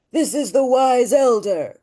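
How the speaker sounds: high, nasal-dull wise elder voice. Shown as a demonstration. Teacher showing how she makes that voice, wise elder. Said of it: "how do I make that voice?".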